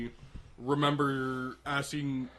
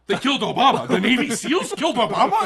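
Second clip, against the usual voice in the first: much higher and louder, laughing; shocked disbelief; 9 semitones higher, 11 dB louder than usual. Retold or imitated. Teen hears mom say Obama was killed, shocked. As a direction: exclaim in stunned disbelief. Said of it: "And I'm like".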